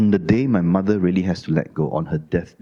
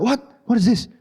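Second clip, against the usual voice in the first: angry outburst; 13 semitones higher than usual. Retold or imitated. Husband demanding answers from wife mid-haircut, high and angry. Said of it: "I was getting very angry".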